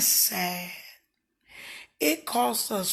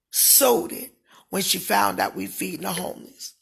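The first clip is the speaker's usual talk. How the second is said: ordinary talk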